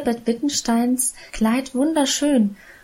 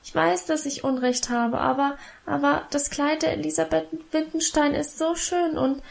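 put-on higher, softer tearful girl's voice; a little girl longing for a pretty dress; whimpering child; 5 semitones higher, 4 dB quieter than usual. A narrator's reading. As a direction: plead through tears, fading out. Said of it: "jammerte ich weiter".

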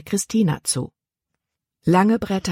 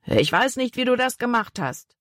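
higher, grudging threat; threatening man; 7 semitones higher than usual. Acted. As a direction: menace the boy, baffled. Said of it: "drohte dem Jungen an Bord mit erhobener Faust". (großer Mann droht dem Jungen)

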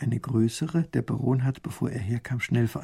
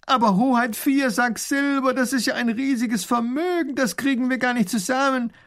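pleading call; 12 semitones higher, 4 dB louder than usual. Acted. Baron calls to the grand duke, protesting the ransom. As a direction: plead with his lord not to pay so much. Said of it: "ruft der Baron".

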